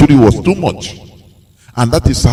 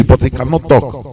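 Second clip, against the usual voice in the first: ordinary talk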